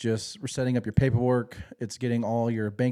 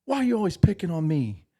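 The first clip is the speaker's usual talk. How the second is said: raised, pleading whine; whiny complaint; 6 semitones higher, 3 dB louder than usual. Retold or imitated. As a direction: complain like a picked-on kid. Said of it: "he was like".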